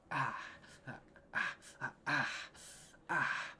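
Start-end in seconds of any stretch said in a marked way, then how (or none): none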